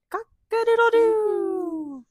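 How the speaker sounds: high, slow, stretched-out crowing; rooster crow. Acted. Reader crowing high and slow for the rooster.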